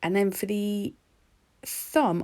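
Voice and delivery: ordinary talk, nothing marked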